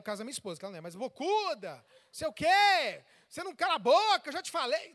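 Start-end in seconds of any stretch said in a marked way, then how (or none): 2.1-4.9 loud, high, fast telling-off; acted angry scolding. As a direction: snap at them to shut up. Acts quarrel with insults.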